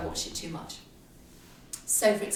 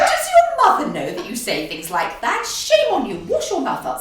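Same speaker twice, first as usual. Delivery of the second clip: much higher and louder, prim telling-off; mock scolding matron; 9 semitones higher, 11 dB louder than usual. Host voices a matron scolding a caller, high and loud.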